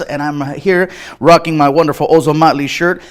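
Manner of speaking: ordinary talk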